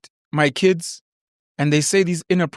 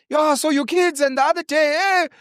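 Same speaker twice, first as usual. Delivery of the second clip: fan greeting; 9 semitones higher than usual. Retold or imitated. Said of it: "someone comes up to him".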